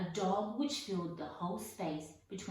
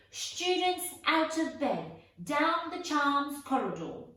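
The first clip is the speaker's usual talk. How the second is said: very loud, much higher bellow; 9 semitones higher, 8 dB louder than usual. Acted. Peeves bellowing to alert the castle, very loud. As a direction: raise the alarm at full voice. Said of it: "Peeves bellowed".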